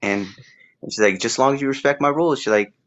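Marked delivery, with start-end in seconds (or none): 1.2-2.4 high and quick, imitating her terms; a lightly voiced old woman laying down conditions; old lady setting rules. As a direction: rattle off the house rules in the old lady's voice.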